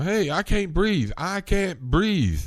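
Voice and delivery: high, slow pleading gasp. Retold or imitated. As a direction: plead for air, drawn out. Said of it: "Eric starts telling them".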